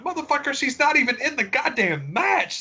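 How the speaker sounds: high, incredulous mock rant; exasperated retort. Wrestling fan venting at whiners, high incredulous rant. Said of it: "I'm like".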